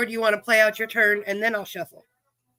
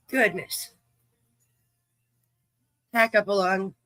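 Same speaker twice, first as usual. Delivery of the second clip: usual speaking voice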